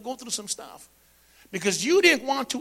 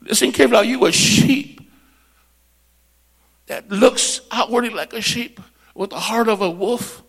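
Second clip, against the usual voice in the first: usual speaking voice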